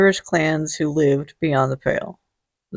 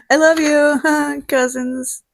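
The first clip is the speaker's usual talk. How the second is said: high, slow, sing-song love; playful affection; 8 semitones higher, much slower than usual. Real, not acted. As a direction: coo it at the cousins. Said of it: "affection after teasing".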